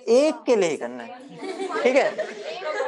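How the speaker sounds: mock-sweet drawl to the class; playful drawn-out. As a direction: stretch it out teasingly, laughing. Teacher repeats point playfully.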